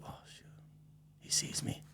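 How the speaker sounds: hushed whisper, busted; caught-out whisper. Retold or imitated. Friend caught by his look, a hushed whisper. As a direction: breathe it like you've been seen through. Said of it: "you guys are like".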